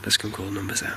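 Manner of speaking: soft whisper. Real, not acted. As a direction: whisper the plan. Ghost hunt, hushed in dark hall.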